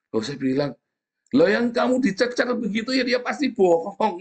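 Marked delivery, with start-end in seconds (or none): 1.4-4.2 mocking interrogator.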